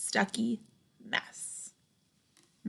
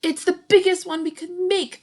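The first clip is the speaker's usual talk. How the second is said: high, louder excited shout; kids shouting; 9 semitones higher, 6 dB louder than usual. Acted. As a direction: call out proudly across the room. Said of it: "they shout".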